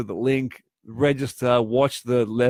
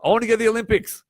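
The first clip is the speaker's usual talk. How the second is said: eager sprinter; 8 semitones higher, much faster than usual. Retold or imitated. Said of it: "if that sprinter were to say".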